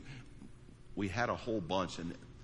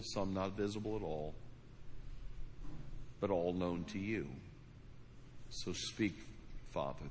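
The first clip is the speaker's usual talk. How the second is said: hushed, earnest pastor's prayer, trailing softer; 3 dB quieter than usual. Acted. Pastor praying over the congregation, earnest and hushed. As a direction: pray it to God, dropping quieter at the close. Pastor prays aloud to God.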